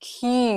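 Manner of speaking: "key" said as kyoo, one joined syllable; k plus you. Shown as a demonstration. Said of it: "เหมือนเค". (ออกเสียง "key" เป็น คิว)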